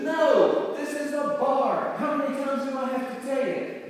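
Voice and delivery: loud, high-pitched mock outburst; exasperated bartender. Bartender to the persistent duck, loudly exasperated. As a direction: act out a bartender at the end of his rope. Bartender loses patience in the joke.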